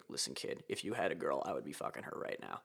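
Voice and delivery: put-on low, half-whispered jab at the kid; hushed mock threat; muttered taunt. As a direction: mutter it under your breath like a smug aside. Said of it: "just be like".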